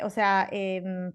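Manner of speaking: normal talking voice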